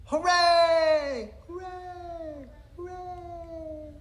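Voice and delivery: high cheering shouts, fading like an echo; shouting hooray. Acted. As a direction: cheer it out, then let it echo away. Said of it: "Penguin shouted".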